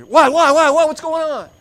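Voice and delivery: high, loud confused shout; jolted awake, yelling in alarm; startled waking yell. Acted. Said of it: "You don't wake up".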